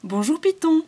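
high, loud happy hello; cheerful greeting. Acted. Chafouin runs up to greet the python, high and loud. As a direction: call out gladly while running up. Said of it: "s'écria Chafouin en accourant".

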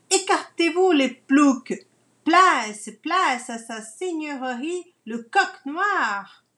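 loud, haughty shout to clear the road; arrogant shout. Acted. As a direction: bellow it like a rude noble.

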